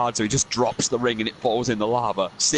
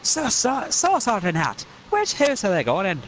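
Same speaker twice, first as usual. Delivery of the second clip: higher Scots question; Scottish voice; 10 semitones higher than usual. Acted. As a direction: ask the hat politely. Asking Sorting Hat.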